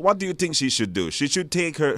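ordinary talk, nothing marked

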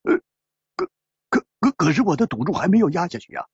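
stammering, then a fast blurt; loud protest. Acted. Loser protesting his bet was never placed, stammering. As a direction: stutter on the first word, then rush it out. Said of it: "大声地说".

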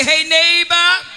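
loud, high call across the room; hollered greeting. Shown as a demonstration. Preacher demonstrating the congregation's call to neighbors, hollered. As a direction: holler it out to someone far off. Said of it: "holler across the room and say".

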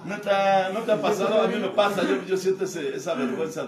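high, loud outburst; loud shout. Real, not acted. Preaching at the group, loud and high. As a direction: shout it at the room.

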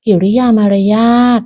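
plain reading voice

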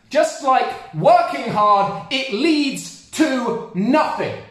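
high, loud, slow shouted tirade; furious rant. Real, not acted. Comic ranting at people who rush onto planes, furious slow shout. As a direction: yell it out in disgust, word by word.

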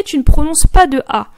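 usual speaking voice

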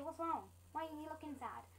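normal talking voice

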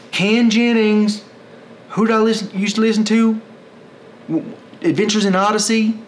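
high, loud put-on voice; silly high voice. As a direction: squeak it out in a goofy voice. Mock voice while trying to recall.

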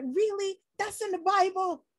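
high, loud amazed outburst stretched slow; loud wide-eyed amazement, drawn out; excited disbelief. Retold or imitated. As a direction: gasp it out like a thrilled stranger hearing news. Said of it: "They're so excited".

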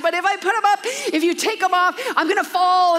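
mock kid protest; scared kid voice. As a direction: whine it like a nervous eight-year-old. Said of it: "And he goes".

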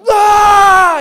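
very loud, high panicked scream; full-force freaked-out scream; yelling. Retold or imitated. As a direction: yell so the neighbors hear. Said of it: "I'm yelling. I'm like".

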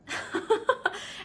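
quick high airy laugh. Real, not acted. After mocking the people-pleaser, high laugh. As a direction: laugh at your own impression.

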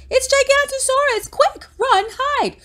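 very high, loud warning shout; high-pitched acted panic yell; crying out in alarm. A narrator's reading. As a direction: shriek the alarm to send friends running. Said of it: "before Bonehead cried".

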